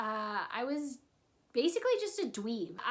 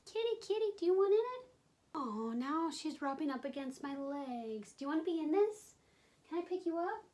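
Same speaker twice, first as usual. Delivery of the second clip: talking to a pet; 4 semitones higher, 4 dB quieter than usual. Real, not acted. Coaxing her cat mid-video.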